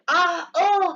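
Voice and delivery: mock groans. Teacher acts Tom's groans.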